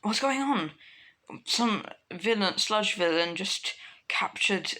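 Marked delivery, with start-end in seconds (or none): 0.0-0.7 higher-pitched, urgent question; alarmed question. Acted. Bystander asking about the villain attack, alarmed. Acted character lines in told story.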